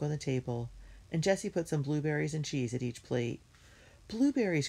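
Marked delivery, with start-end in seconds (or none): 4.1-4.7 high-pitched one-word exclamation; delighted cry. A narrator's reading. Henry sees blueberries on his plate, crying out delightedly. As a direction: exclaim it high with happy surprise. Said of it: "cried Henry".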